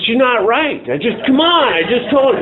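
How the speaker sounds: high, loud insistent denial; defensive protest. Retold or imitated. Retelling his protest to his sponsor, high and loud. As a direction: push back hard, refusing to hear it.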